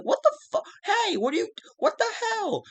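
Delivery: imitating a stunned, cracking yelp; shocked squeal. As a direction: squeal it like you just got robbed. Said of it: "Bodas is like".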